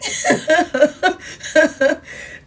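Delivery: real amused laughter; long burst of giggles; laughing. Real, not acted. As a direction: laugh it out at your own joke. Laughs at own joke.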